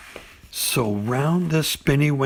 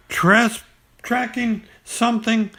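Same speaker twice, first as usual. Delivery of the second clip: mysterious Pooh; 10 semitones higher than usual. Acted. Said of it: "very mysteriously".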